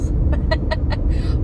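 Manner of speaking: quick run of chuckles; amused laugh. Real, not acted. Vlogger joking about Southern slurring, amused laugh. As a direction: laugh at your own joke.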